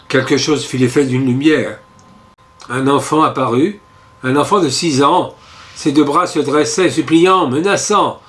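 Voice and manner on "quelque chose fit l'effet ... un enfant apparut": ordinary delivery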